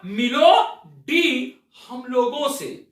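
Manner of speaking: "मिलो डी हम लोगों से" drawn out slowly, each word apart; slow, deliberate. Shown as a demonstration. Teacher slowly repeating the pun line for students. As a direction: spell it out slowly for the class. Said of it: "तो मैंने क्या बोला?".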